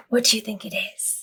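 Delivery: hushed suspenseful question to listeners. Shown as a demonstration. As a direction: lean in and ask the kids. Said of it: "reader asks children".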